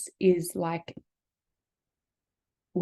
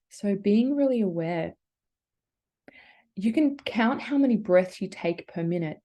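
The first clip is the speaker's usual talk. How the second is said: ordinary talk, nothing marked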